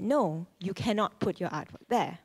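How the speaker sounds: ordinary delivery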